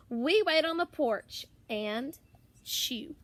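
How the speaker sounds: ordinary delivery, nothing marked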